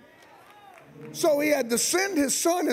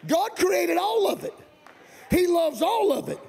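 higher, shouted, stretched preacher build; preaching climax; 6 semitones higher than usual. Real, not acted. Pastor driving home God loves all of it, shouting. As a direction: build the congregation to a peak.

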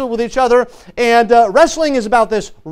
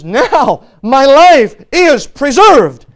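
loud, slow and drawn-out declaration; ringing preacher's exclamation as Jacob; triumphant realization; 3 semitones higher, 6 dB louder, much slower than usual. Acted. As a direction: proclaim each word with full force.

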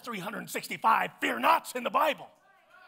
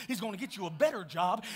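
ordinary talk

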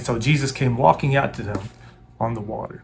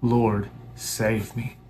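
slow plea; 4 dB quieter, much slower than usual. Shown as a demonstration. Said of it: "crying out".

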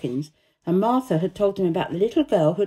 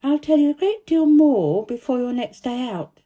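put-on higher, louder young girl; little girl from India; 6 semitones higher, 5 dB louder than usual. A narrator's reading. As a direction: voice a proper little girl, pitched up. Narrator voices Mary.

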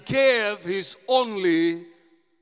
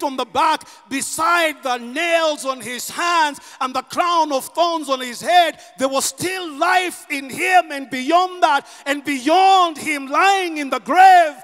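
preaching at full force; 3 semitones higher, 4 dB louder than usual. Acted. Pastor to congregation about Christ's life, rising preaching. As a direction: preach it rising to a shouted peak.

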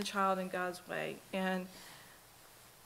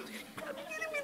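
exasperated laugh; 9 dB quieter than usual. Real, not acted. Child disobeys yet again.

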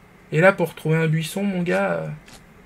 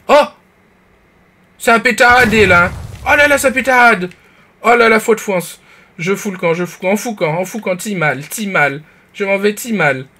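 panicked shouting; 5 semitones higher, 8 dB louder than usual. Real, not acted. Panicking under fire in game.

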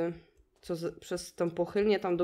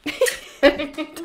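głośny, wysoki śmiech; 9 semitones higher, 12 dB louder than usual. Real, not acted. Śmiech na pożegnanie odcinka. (prawdziwy, głośny wybuch śmiechu)

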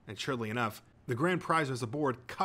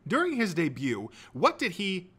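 higher, emphatic quiz-show host; quizmaster; 7 semitones higher, 5 dB louder than usual. A narrator's reading. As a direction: pose the question with showy suspense. Quizmaster reads a question.

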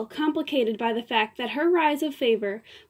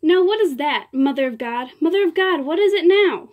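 loud, high, furious outburst; a diva's angry cry; 4 semitones higher, 5 dB louder than usual. Acted. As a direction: cry out angrily at him. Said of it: "cry angrily".

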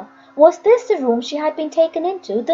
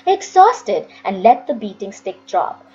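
plain reading voice

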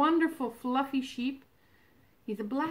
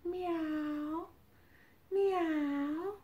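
high, drawn-out kitty meow; cat meow; 6 semitones higher than usual. Pretend. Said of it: "Mine says".

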